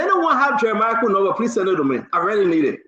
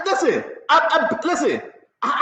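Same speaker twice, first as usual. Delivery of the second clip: normal talking voice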